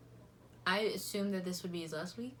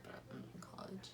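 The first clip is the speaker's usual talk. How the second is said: whispered aside; 16 dB quieter than usual. Real, not acted. Considers lying about age.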